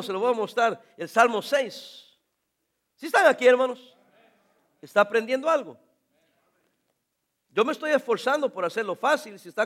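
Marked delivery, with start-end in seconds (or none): none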